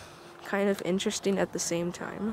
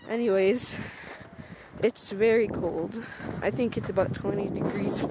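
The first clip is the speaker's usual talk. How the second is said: ordinary talk, nothing marked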